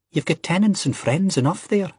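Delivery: high, insistent Scottish objection; indignant protest in a high voice. A narrator's reading. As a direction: argue back, pitched right up. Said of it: "Ian protested".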